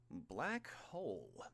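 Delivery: slow, offhand repeat of the attack name; conversational. A narrator's reading. Villain casually echoing the hero's attack, slow and offhand. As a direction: say it idly, as if chatting. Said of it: "Kurogiri said conversationally".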